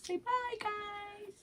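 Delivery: high, slow coaxing; baby talk. Acted. Mom prompting the baby to say bye, high baby talk.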